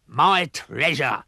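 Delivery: very slow, drawn-out pirate cry; pirate yell. A narrator's reading. Pirate captain spots his treasure chest, slow yell. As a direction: claim the loot.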